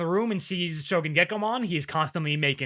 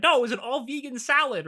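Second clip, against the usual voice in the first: mock scream; 10 semitones higher than usual. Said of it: "hear them scream out".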